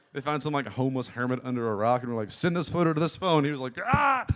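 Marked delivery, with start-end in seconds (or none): 2.3-3.4 slightly raised, higher instructing voice; instructing a test subject. Acted. Acts out Samsung testers giving order. 3.9-4.3 high, loud wordless hermit cry; short mock scream; hermit's yell. Acts startled hermit's reply.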